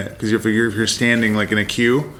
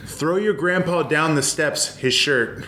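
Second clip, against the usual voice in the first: lightly voiced rural relative, slow; Pennsylvania Dutch voice; 6 semitones higher, slower than usual. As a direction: quote the old folks, higher and slow. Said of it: "they would all speak in this very specific way".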